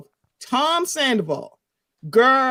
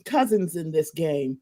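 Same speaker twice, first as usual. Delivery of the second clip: normal talking voice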